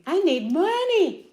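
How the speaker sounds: high, loud whine on money; mock desperate plea. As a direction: playfully beg the viewers for money. Mom jokingly begging viewers for money.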